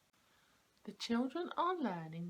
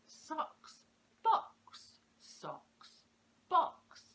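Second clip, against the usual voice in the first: "Socks" whispered, then "box" much higher and louder; rhyme demo; 9 semitones higher, 7 dB louder, much slower than usual. Shown as a demonstration. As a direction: push the rhyme of "box" out bright and loud. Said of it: "emphasising the words".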